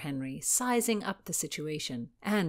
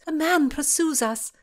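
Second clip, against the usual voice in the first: breathless; 10 semitones higher, 7 dB louder than usual. Acted. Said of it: "Constance was breathless".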